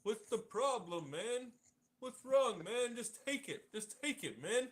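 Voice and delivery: a cartoonish, nagging Biden parody pressing the vaccine; mocking Biden. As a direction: ham it up, pushy and whiny. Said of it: "Biden even said this".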